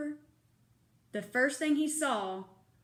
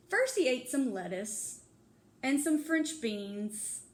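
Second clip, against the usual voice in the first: plain reading voice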